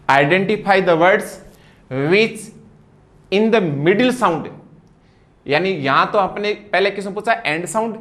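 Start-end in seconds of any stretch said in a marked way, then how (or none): 0.1-4.5 slow dictation. Shown as a demonstration. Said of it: "reads question slowly, higher".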